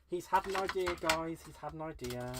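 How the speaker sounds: loud teasing proclamation; mock announcement. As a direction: announce it like big news. Teasing self in third person.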